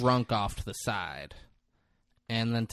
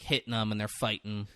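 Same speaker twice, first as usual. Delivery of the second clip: ordinary delivery, nothing marked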